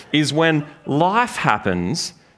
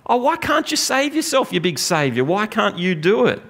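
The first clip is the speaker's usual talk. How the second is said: much higher, sneering jeer; mocking taunt; 7 semitones higher than usual. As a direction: taunt him like a passer-by at the cross. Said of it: "they're mocking him".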